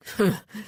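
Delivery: short cold laugh; mirthless laugh. A narrator's reading. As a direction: laugh without any joy. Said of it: "with a mirthless laugh".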